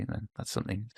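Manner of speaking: usual speaking voice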